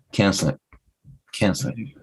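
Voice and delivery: "Can't sleep" said like "kensle"; run-together "Can't sleep", ending cut off; reduced pronunciation. Shown as a demonstration. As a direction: blend "Can't sleep" into two short syllables. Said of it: "K E N S L E".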